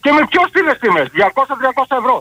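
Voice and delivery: usual speaking voice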